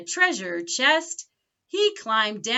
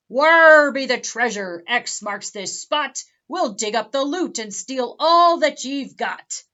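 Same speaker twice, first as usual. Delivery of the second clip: loud, rough pirate bellow; pirate demanding the treasure, bellowing; booming pirate; 2 semitones higher, 7 dB louder than usual. Acted. Big Pete storms into the library, loudly demanding loot.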